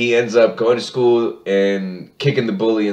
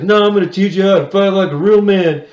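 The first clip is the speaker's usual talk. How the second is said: mock irritated dad, pitched up; pissed-off Hank; 6 semitones higher than usual. Hank vowing to teach his son fighting, mock annoyed. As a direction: lecture like an annoyed cartoon father. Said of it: "Hank gets really pissed".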